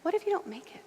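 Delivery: high, soft whisper of doubt; small, hushed, needling voice; doubting inner voice. Retold or imitated. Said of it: "Do you ever hear that voice?".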